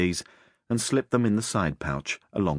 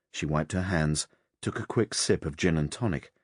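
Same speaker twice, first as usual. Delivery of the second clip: ordinary delivery, nothing marked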